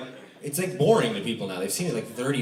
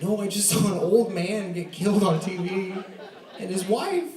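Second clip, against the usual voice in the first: higher, tearful mock complaint; upset wife; 9 semitones higher than usual. Wife upset at the TV execution, mock tearful. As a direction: whine it out, shocked and upset. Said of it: "And she's like".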